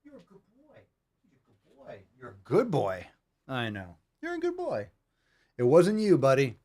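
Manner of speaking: soothing dog talk. Real, not acted. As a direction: reassure the scared dog gently. Comforting the startled dog.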